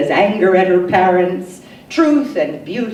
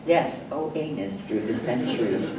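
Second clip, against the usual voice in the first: much softer, murmured aside repeating the refrain; hushed echo; 7 semitones lower, 10 dB quieter, faster than usual. Acted. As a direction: drop it to a near murmur, faster.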